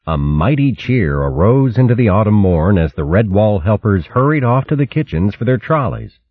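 ordinary delivery

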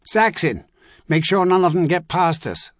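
high, loud order; shouted command. A narrator's reading. Officer ordering Saxon to hold the line, loud high shout. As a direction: bark the order across the dark. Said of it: "that same voice shouted".

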